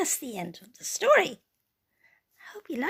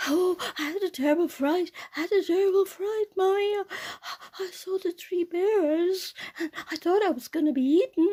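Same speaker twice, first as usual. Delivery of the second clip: frightened; 7 semitones higher, 5 dB louder than usual. Acted. Frightened Goldilocks.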